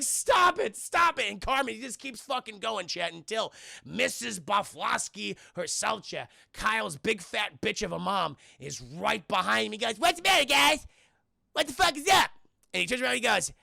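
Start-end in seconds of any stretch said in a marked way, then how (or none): 0.0-1.3 high, loud yell to stop; raised, insistent mock shout; mock angry protest. Acting out Kyle protesting. 10.0-12.3 high, loud acted-out yell; raised-voice character shout; mock shouting. Acting out Cartman shouting to friends.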